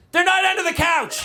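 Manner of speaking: high-pitched mock kid denial; little kid protesting too quickly; child's panicked denial. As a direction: blurt it high like a guilty child.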